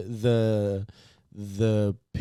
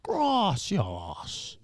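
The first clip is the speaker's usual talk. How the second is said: muffled villain growl like Bane; menacing masked-villain gracias; 8 semitones higher than usual. Retold or imitated. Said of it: "Like Bane".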